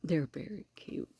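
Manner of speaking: soft unvoiced whisper. Real, not acted. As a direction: whisper so the raccoons stay calm. Whispering near the baby raccoons.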